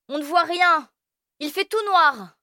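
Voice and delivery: very high insistent; bossy kid. Acted. Firmin insisting it's too dark to leave.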